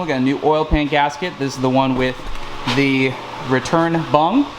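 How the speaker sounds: ordinary talk, nothing marked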